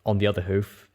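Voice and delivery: usual speaking voice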